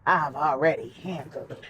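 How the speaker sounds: mimics him low and soft; a hint of his deep voice, dropped low; baritone voice. Wife mocking her husband's baritone voice. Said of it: "with that baritone voice talking about".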